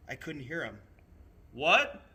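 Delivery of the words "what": loud high shout; yelled out. A narrator's reading. Boy shouting to the moving train, loud. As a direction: call out across the noise. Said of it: "I yelled out".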